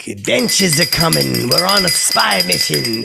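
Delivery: loud, high, slow hammed-up announcer; loud high mock spy announcement at the bowling alley. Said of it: "I go like".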